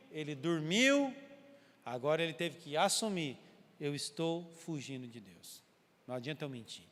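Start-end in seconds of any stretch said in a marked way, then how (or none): 3.8-6.9 resigned admission. Retold or imitated. Jonah admitting he is fleeing God, quiet and resigned. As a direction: give up the truth quietly.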